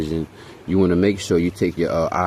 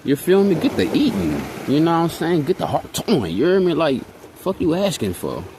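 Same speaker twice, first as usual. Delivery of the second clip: mock exasperated retort; 9 semitones higher than usual. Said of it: "I'd be like".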